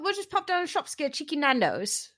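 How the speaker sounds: high, breezy British voice; cheeky Londoner suggesting food; British accent. Pretend. Mocking UK Brian.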